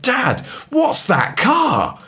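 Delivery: put-on high, slow, wondering child's cry; excited child. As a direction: call out like an amazed kid. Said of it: "and he went".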